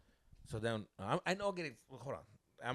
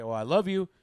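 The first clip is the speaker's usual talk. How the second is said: pointed declaration; 5 semitones higher, 12 dB louder than usual. Retold or imitated. Boyfriend hoping to hear it back.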